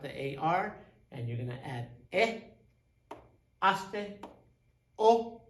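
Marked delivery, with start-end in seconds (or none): 5.0-5.4 "o" said as a strong, higher ó; stress the ending. Shown as a demonstration. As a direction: lean hard on the "o" ending. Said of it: "you gotta stress that".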